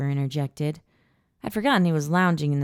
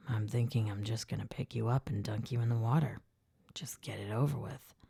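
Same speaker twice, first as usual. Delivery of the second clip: low, soft murmur of a teasing guy; lightly voiced man, half-whispered tease; a hint of a low, playful man's voice; teasing Jake; 5 semitones lower, 10 dB quieter than usual. Narrator voicing Jake low.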